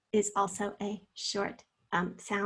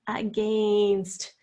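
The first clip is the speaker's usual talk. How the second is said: against, with a long-held second syllable; drawn-out word; 2 semitones lower than usual. Shown as a demonstration. Teacher helping pupils hear each sound, drawn out. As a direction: stretch AGAINST slowly, holding the gain. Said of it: "kind of draw that out".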